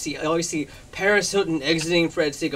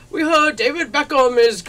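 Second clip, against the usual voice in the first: raised, louder attempt at English accent; bad English accent; 9 semitones higher, 6 dB louder than usual. London tabloid phones the shop, shaky English accent attempt. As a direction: try an English accent and miss. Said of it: "I can't do an English accent".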